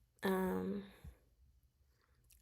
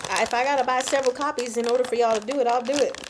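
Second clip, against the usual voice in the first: fast and loud, pitched up in eager begging; eager plea; 6 semitones higher, 6 dB louder, faster than usual. Real, not acted. Fan begging the publisher for editions, fast eager plea. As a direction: rush it out, half-joking desperation.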